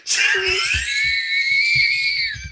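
real howling laugh; shrieking laugh. Real, not acted. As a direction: crack up helplessly. Host cracks up at guest.

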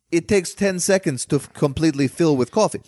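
mock director's accent, meant to be French; bad foreign accent. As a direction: explain it in a put-on European accent. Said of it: "I don't know why I'm doing this voice".

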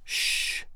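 soft, hissed "shh"; a quiet warning hush; cautioning shush. A narrator's reading. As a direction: shush someone gently. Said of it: "cautioned Clayton".

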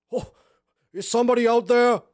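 high, loud cry through a door; trapped captive calling out urgently. Acted. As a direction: shout for whoever is outside.

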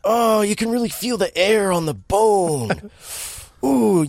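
high, hammed-up moaning; mock pained whining. Henchman with a severed finger, hammy pained moaning. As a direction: moan melodramatically, stretching the vowels. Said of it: "he reacts the same way".

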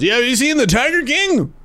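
high-pitched, out-of-touch dad; mock dad pushing a show. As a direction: ask it like a dad recommending television. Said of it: "something my dad would tell me".

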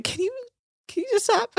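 high, halting mock plea; exasperated plea, imitated. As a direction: squeak out a helpless request. Captain pleading, acted.

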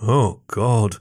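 mildly exasperated groan. A narrator's reading. Jason gives in to the fox's fake tear, groaning. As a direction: groan the words with playful exasperation. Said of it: "he groaned".

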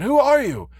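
high, loud, alarmed question; startled old man crying out; shocked exclamation. A narrator's reading. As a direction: demand it in shock, pitch soaring. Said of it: "exclaimed the old man".

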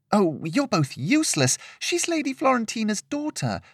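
high-pitched, exasperated gossip. Acted. Gossip to two blank-faced friends, high exasperation. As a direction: scold them for not knowing.

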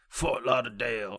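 put-on local Fort Lauderdale accent; joking stab at a Floridian accent; guessed Fort Lauderdale accent. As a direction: try out a made-up Florida accent. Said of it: "that's what I'm going with accent-wise for Fort Lauderdale".

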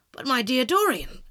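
loud, slowed protest of disbelief; shocked exclamation. Acted. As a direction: exclaim in dismay. Henry amazed at the news.

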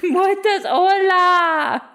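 laughing question. Acted. Acts confused student, laughing.

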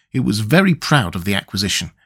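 plain reading voice

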